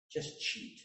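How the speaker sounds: soft, unvoiced tempting aside; sly whisper. Ungodly voice urges a student to cheat, whispered. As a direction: slip the bad advice in quietly.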